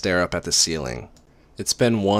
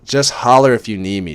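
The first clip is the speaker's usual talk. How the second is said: usual speaking voice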